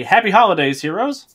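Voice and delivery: high, loud holiday greeting; cheery group prompt. Pretend. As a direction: call it out brightly for the group to echo. Host prompts players to greet audience.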